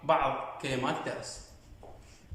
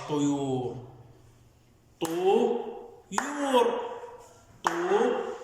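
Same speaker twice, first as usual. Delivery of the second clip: slow, stretched طيور; syllable drill; much slower than usual. Shown as a demonstration. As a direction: draw out طيور so each syllable is heard. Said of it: "راح نقطعها". (تقطيع كلمة طيور ببطء)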